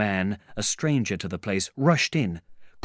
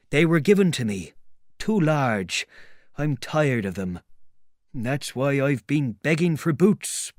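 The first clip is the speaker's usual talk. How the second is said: higher-pitched tramp's grumble, punching the repeated word; complaining tramp; 5 semitones higher, 3 dB louder than usual. Acted. Tramp telling a stranger about begging for boots, complaining. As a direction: air a grievance as a put-upon tramp.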